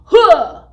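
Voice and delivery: sharp, loud shouted "huh"; chant-ending "huh". Shown as a demonstration. Teacher ending the school chant, loud punched "huh". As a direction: punch out a high, explosive "huh". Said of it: "at the end there is a huh".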